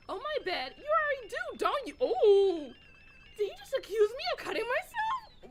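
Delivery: put-on girl stunned by a cruel jab; shocked outrage. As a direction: gasp out the accusation. Shocked accusation in quarrel.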